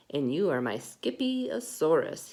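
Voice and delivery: ordinary delivery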